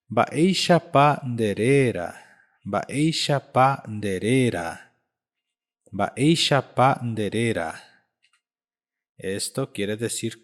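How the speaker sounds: ordinary delivery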